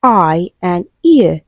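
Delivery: slow, separated "I" and "ear"; drawn-out vowel glides on "I" and "ear"; two diphthongs demonstrated. Shown as a demonstration. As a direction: sound out "I" and "ear" slowly as two gliding vowels. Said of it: "we have two diphthongs".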